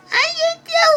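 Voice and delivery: high denial. Pretend. Trina denies making Goku cry, high squeaky protest. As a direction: protest innocence in a squeaky kid voice.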